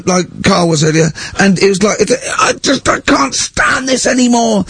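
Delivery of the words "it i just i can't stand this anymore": high, slow exasperated shout; teacher losing it. Retold or imitated. Teacher at breaking point with pupils, high strangled shout. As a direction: boil over with despair. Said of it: "going mad, going red and bursting".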